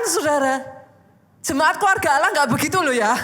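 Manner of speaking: high, loud exasperated preaching. Real, not acted. Preacher fed up with members who want every part prayed over, exasperated. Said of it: "Saya tuh kan paling gemes".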